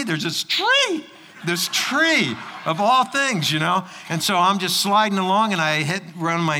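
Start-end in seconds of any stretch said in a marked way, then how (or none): 0.0-3.5 high, slow, dreading There's this tree; alarmed surprise. Retold or imitated. Sudden danger in the story.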